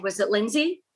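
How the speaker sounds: ordinary delivery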